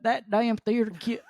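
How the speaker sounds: high, slow Southern drawl; Southern accent. Acted. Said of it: "giving him a thick Southern accent".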